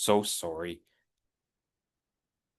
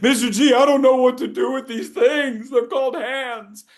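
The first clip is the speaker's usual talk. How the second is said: higher, louder, laughing student whine; whiny student; 6 semitones higher, 4 dB louder than usual. Retold or imitated. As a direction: play a helpless student. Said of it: "they all have the same reaction".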